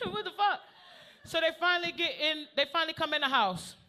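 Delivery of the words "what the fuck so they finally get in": normal talking voice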